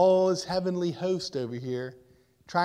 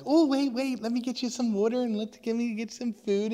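higher, quick eager call; eager offer; 7 semitones higher than usual. Acted. You offering water and food to the returning dog, eager higher voice. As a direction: hurry to offer it something before it leaves. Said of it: "and you're like".

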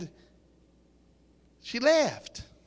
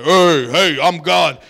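booming, much louder, drawn-out heavenly announcement; big audible voice of God; 4 semitones lower, 14 dB louder, slower than usual. Acted. Preacher parodying God calling from the opened heavens, big booming voice. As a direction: boom it out as a mock God from the heavens. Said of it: "It's not this big audible voice".

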